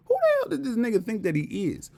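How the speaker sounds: high-pitched mock incredulity; put-on indignant disbelief; mock disdainful hater. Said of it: "This is how they look at you".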